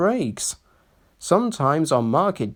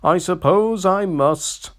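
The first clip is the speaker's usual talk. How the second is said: sulky; 6 semitones higher, 3 dB louder than usual. A narrator's reading. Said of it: "said Sir Handel sulkily".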